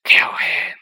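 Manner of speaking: loud, forceful hissed whisper; harsh voiceless hiss, loud and sudden; Riddle's hissing voice. A narrator's reading. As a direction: hiss the command out with venom. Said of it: "he heard Riddle's hissing voice".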